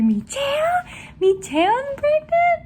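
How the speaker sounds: very high incredulous imitation; shocked. Youngest learns Michelle is pregnant, high shocked imitation. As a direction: squeak both questions in mock disbelief. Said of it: "he was even more shocked".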